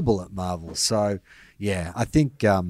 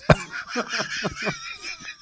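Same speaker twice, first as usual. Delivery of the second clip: high squealing laugh; 6 dB quieter than usual. Real, not acted. Laughs at own joke.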